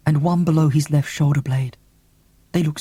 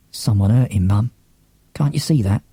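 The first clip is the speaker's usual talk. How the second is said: usual speaking voice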